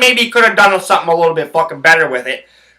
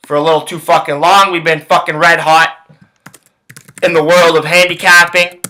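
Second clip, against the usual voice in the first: ordinary talk, nothing marked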